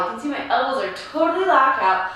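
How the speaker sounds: ordinary talk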